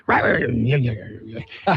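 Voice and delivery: imitated barking jabber; barking checkout guy. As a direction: yap like a grumpy cashier. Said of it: "he almost barks at you".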